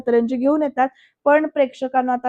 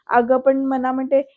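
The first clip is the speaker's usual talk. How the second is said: ordinary delivery, nothing marked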